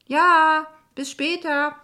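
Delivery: high, loud drawn-out reply from a distance; calling back. Acted. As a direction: shout a quick goodbye over your shoulder. Calling back while hurrying out.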